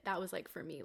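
usual speaking voice

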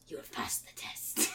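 very soft, low whisper; mysterious whisper. Acted. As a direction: breathe it like a spirit's verdict. Acts a mysterious voice.

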